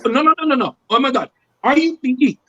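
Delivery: put-on flustered fan with an Indian accent; imitated Indian accent. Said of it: "And this Indian guy".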